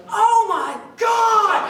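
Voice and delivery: high, loud drawn-out scream of "Oh my God"; high loud panicked cry; shriek of fright. Retold or imitated. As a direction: shriek like a terrified rider at the drop. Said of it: "the girl behind me goes".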